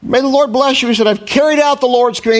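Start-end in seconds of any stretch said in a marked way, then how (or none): 0.0-0.9 high, bright mock-cheery hypocrite greeting; cheerful greeting. Saul greeting the prophet with fake cheer. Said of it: "Saul greeted him cheerfully". 1.1-2.4 high, pleased boast of obedience; self-satisfied claim. Retold or imitated. Saul boasting to the prophet about obeying. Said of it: "He really thinks he followed".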